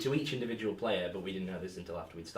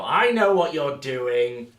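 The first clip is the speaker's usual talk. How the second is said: loud, slow, higher accusing voice; mock knowing call-out; 5 semitones higher, 13 dB louder, much slower than usual. Player catching the GM's trick, slow loud call-out. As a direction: stretch it out, catching them in the act. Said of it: "you will go".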